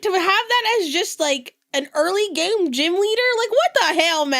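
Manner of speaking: high, loud, exasperated complaint; outraged on behalf of every player; loud disbelieving whine; indignant outburst. Real, not acted. Complaining about the gym leader.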